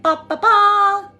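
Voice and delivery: high, loud sung trumpet "ba-ba-baaa"; triumphant fanfare. Acted. Old flame announces his return to feminine, mock fanfare. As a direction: herald yourself with a mock fanfare, last note held long. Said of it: "old energy coming back to a feminine right now that's being like".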